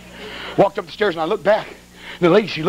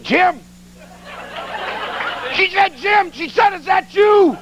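wife calling out; 11 semitones higher, slower than usual. Retold or imitated.

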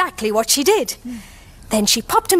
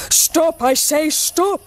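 higher, slow-paced call to stop; old man's cry; 3 semitones higher, much slower than usual. A narrator's reading. Old man calling after the gingerbread man, mild cry. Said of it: "cried the little old man".

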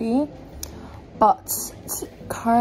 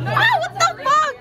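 high startled shriek; surprised shriek; 5 dB louder than usual. Real, not acted. Sudden surprise at club.

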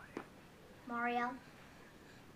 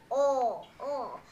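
lower, soft oh calls; Yoshi voice; 6 semitones lower, 5 dB quieter, much slower than usual. Shown as a demonstration. Demoing their Yoshi for viewers, low. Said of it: "this Yoshi talks more like".

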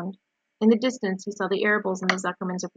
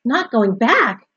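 louder, stretched-out cry of disbelief; alarmed cry; 3 semitones higher, 7 dB louder, slower than usual. A narrator's reading. Wilbur leaps up at Charlotte's news, crying out. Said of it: "he cried".

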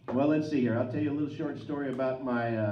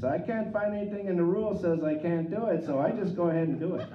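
hamming up a North Dakota uncle, pitched up; North Dakota uncle accent; 5 semitones higher, faster than usual. Retold or imitated. Nephew imitating his North Dakota uncle's fishing logic in accent. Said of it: "he's got that North Dakota kinda accent".

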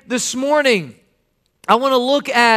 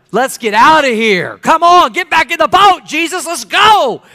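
loud, high-pitched mock alarm; panicked shout; 6 semitones higher, 7 dB louder than usual. Disciples urging Jesus back into the boat, shouting.

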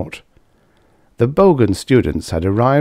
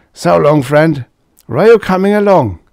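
a somewhat louder, higher tipsy loudmouth; Karsh, tipsy braggart; 9 semitones higher, 7 dB louder than usual. A narrator's reading. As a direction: play the boozy blowhard at the bar. Karsh voiced in story.

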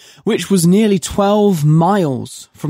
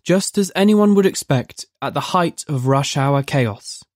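usual speaking voice

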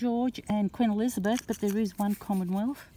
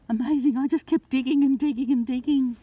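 excited; 5 semitones higher, 6 dB louder than usual. Real, not acted. Excited find while metal detecting.

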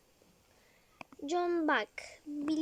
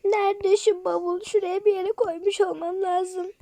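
ordinary talk, nothing marked

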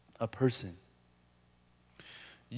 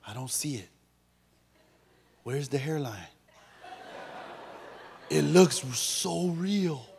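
softer, musing inner voice; inner thought; 2 semitones lower, 7 dB quieter than usual. Retold or imitated. Pastor voicing a congregant's private thoughts about his hairline. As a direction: mutter the secret thought to yourself. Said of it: "With your mind, you're going".

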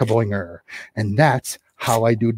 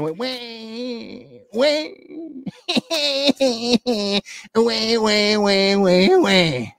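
mock baby wailing; 9 semitones higher than usual. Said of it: "I'm just acting".

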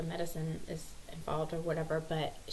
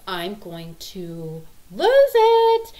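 exasperated outburst; 12 dB louder, much slower than usual. Retold or imitated. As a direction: stretch it out to a mock breaking-point yell. Mom at breaking point.

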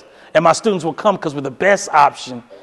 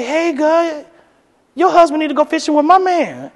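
put-on much higher girlfriend gossip voice; wife bragging to her girlfriend, high gossipy voice; 9 semitones higher than usual. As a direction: dish it like salon chat. Wife tells girlfriend at nail salon.

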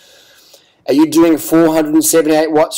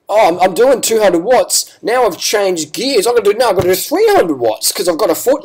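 higher-pitched mock rider voice; mocking imitation; 6 semitones higher than usual. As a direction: parody a clueless rider, pitched up. Mocks rider's reasoning in quote.

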